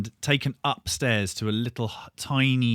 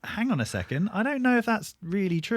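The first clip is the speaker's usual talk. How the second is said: incredulous objection; 11 semitones higher than usual. Retold or imitated. Band member objecting to promoter.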